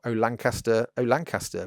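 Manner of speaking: ordinary delivery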